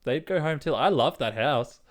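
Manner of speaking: ordinary delivery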